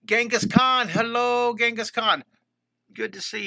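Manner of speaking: high and loud joyful hello; excited greeting. Real, not acted. Art teacher greeting a regular viewer in chat, high and loud.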